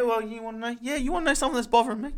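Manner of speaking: high, fast riled-up burst; fired up and ready to rant. Real, not acted. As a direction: launch into a rant, wound up. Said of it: "I'm really fired up".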